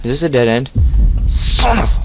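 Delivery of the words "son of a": startled cry. Real, not acted. Cut-off curse at a scare.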